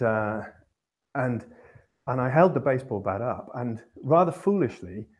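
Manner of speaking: ordinary talk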